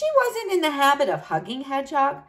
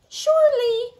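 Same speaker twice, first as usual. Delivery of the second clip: chirpy high reply; 11 semitones higher, 3 dB louder than usual. Acted. Bunny's cheerful reply.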